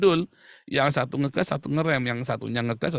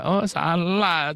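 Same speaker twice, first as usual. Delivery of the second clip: normal talking voice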